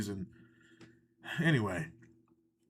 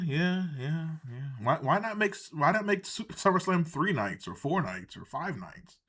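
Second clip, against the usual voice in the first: ordinary talk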